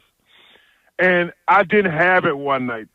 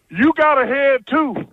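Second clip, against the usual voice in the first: coach's comeback, higher and slow; retold comeback; 8 semitones higher, slower than usual. Retelling his coach's comeback to the locker-room threat, higher, drawn-out retort. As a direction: imitate a coach firing back, pitched up and drawn out. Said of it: "without hesitation he says".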